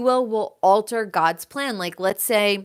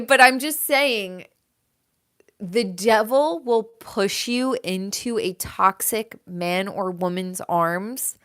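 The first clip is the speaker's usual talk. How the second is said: usual speaking voice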